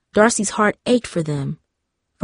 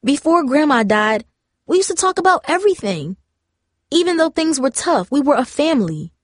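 higher-pitched, hurt frustration; frustrated; 6 semitones higher than usual. A narrator's reading. Darcy confronting her parents about drifting apart, frustrated. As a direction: pour out a pent-up complaint. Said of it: "frustrated at her own words".

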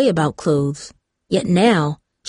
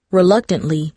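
ordinary delivery

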